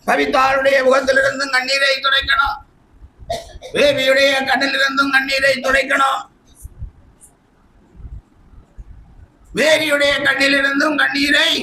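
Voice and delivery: high, level preaching cadence; insistent pulpit declamation; impassioned preaching. Acted. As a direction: hold each phrase up high, like a proclamation. Preaches at raised pitch.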